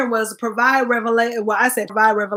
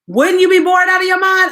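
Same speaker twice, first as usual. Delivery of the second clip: excited; 7 semitones higher, 5 dB louder than usual. Real, not acted. Snapping out of monotone demo.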